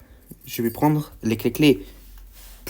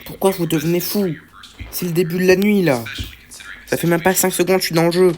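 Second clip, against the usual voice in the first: higher-pitched indignant complaint; exasperated protest; 4 semitones higher than usual. Real, not acted. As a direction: plead in disbelief at the unfairness. Protests at enemies moving so early.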